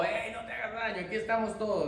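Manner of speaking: Paul's cry shouted out, pitched high; shouting. Retold or imitated. Paul cries out to the jailer to stop him, shouting. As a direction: call out urgently across the dark prison. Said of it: "no, gritó".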